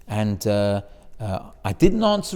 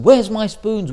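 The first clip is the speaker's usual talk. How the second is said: angry demand; 5 semitones higher, 8 dB louder, slower than usual. Retold or imitated. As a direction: demand it loud and high, like a furious merchant. Merchant beating, demanding spoons.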